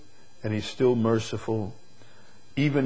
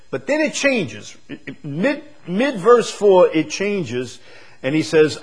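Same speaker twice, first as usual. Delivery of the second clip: rising preacher's voice, louder; preaching; 8 semitones higher, 6 dB louder than usual. Acted. Preacher lifting his voice to the congregation. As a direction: preach it out with force.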